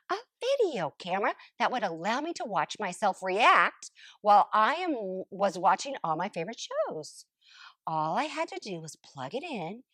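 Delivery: plain reading voice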